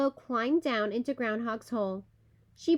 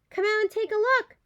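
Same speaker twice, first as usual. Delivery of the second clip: calling out; 8 semitones higher, 4 dB louder, faster than usual. A narrator's reading. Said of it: "called Groundhog".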